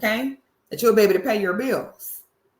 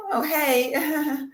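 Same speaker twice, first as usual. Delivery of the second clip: curt, dismissive little hello; mock-snarky brush-off; snarky short greeting; 5 semitones higher than usual. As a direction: cut her off with a cold hi. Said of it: "They either get real snarky, real short".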